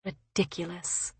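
very soft, inward remark; private thought. A narrator's reading. Bride thinking to herself after the kiss, hushed. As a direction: drop to a near-murmur, as if thinking. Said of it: "she thought".

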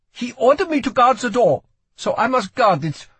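put-on high, loud, accented young voice; earnest foreign boy insisting on his duty; desperate boy guard. A narrator's reading. Boy shows Lila his mark, desperate insistence.

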